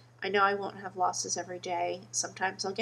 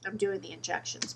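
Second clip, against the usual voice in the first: normal talking voice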